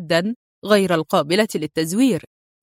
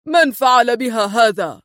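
a louder, angry demand; 2 semitones higher, 4 dB louder than usual. Acted. Adham sees her battered face, angry and louder. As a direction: bark the question at the men. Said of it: "فقال في غضب".

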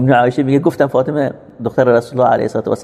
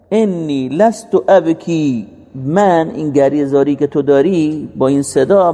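higher, drawn-out sermon delivery; impassioned preaching; 5 semitones higher than usual. Retold or imitated. Preacher relaying the Prophet's answer about his tears, impassioned preaching. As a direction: preach it slowly, phrase by phrase, pitched up.

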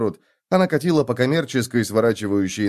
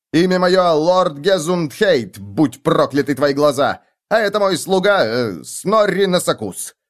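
pompous fake lord; 6 semitones higher, 4 dB louder than usual. Bluffing noble invents names.

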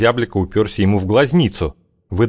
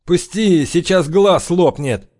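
хрипло; 10 semitones higher, slower than usual. A narrator's reading. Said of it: "прохрипел главарь".

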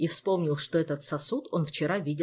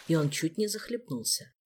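usual speaking voice

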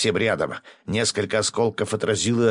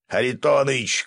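raised, higher-pitched call; mild shout; 6 semitones higher, 3 dB louder than usual. A narrator's reading. Свят calls to the colonel as he jumps up, mild shout. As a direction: call out to get his attention. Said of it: "крикнул Свят".

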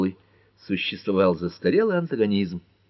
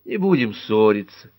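plain reading voice

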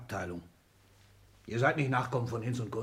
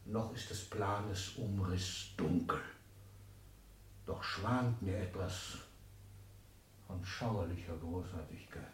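brooding aside; 8 semitones lower, 14 dB quieter than usual. Acted. Father alone, plotting in a hushed brooding aside. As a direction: muse darkly to yourself.

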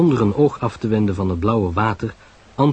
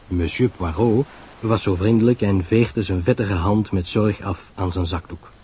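plain reading voice

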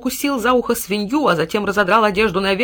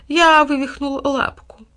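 slow, high, plaintive cat; drawn-out fake suffering; hypocritical mewing; 6 semitones higher, slower than usual. A narrator's reading. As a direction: whine with feigned pain. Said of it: "с лицемерной болью в голосе промяукал кот".

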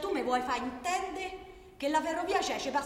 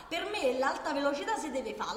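normal talking voice

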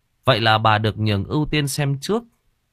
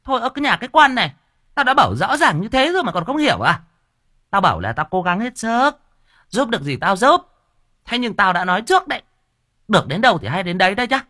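much higher, louder, fast scolding; snapping at her; 11 semitones higher, 4 dB louder, faster than usual. Acted. Medium scolds the dazed wife, loud and fast. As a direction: bark it out, losing patience. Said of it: "cô Đồng Sinh quát".

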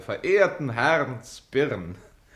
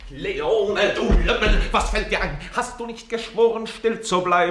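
angry scolding; 7 semitones higher, 5 dB louder than usual. Acted. Scolding the captain.

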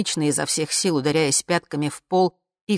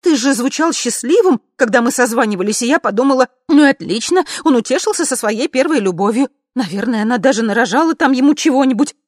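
high, loud, upset babbling; high-pitched, worked-up self-justification; agitated rant; 8 semitones higher, 8 dB louder than usual. A narrator's reading. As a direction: pour out hurt reproaches.

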